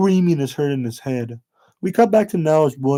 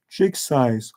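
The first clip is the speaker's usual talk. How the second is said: ordinary delivery, nothing marked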